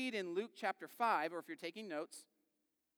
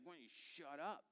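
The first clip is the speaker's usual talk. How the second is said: much softer, under the breath; muttered annoyance; 11 dB quieter than usual. Acted. Annoyed fisher to a nosy passerby, muttered aside. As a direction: grumble it privately, barely voiced.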